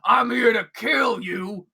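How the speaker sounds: slow, loud threatening shout; menacing threat. Acted. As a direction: drag out the threat. Goblin threatens Gleb.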